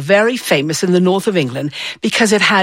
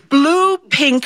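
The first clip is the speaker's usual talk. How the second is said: ordinary delivery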